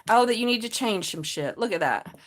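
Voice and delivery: ordinary talk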